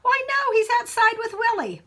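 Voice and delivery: mother's voice. Acted. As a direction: sing it out as the mother, high and bright-voiced. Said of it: "His mother answered".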